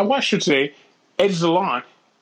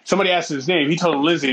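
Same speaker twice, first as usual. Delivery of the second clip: usual speaking voice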